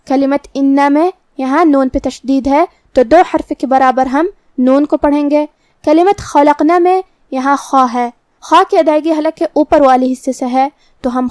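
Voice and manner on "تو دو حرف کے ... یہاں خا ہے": normal talking voice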